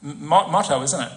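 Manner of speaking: normal talking voice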